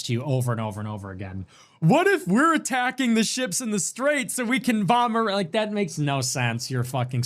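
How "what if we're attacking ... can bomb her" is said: high, goofy voice of a clueless viewer; mocking dumb chatter. Mimicking a viewer's dumb question in a dopey voice.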